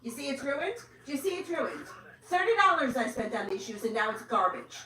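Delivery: high-pitched exasperated telling-off; real angry scolding. Real, not acted. Mother berating her daughter about the ruined shoes, angry scolding.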